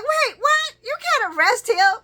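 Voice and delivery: high, loud, shocked disbelief; Laura caught off guard, voice jumping up; shocked gasp. Retold or imitated. Laura reacting to arrest threat, loud shocked disbelief.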